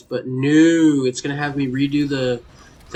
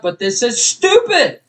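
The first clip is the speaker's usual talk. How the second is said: high, loud furious yelling; real rage at the game; yelling at the level designer; angry rant; 6 dB louder than usual. Real, not acted. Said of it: "I apologize for that".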